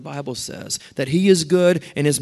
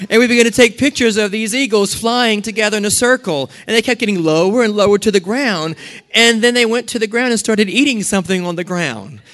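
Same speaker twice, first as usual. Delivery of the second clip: excited retelling; 6 semitones higher, 4 dB louder than usual. Retold or imitated. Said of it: "And she says".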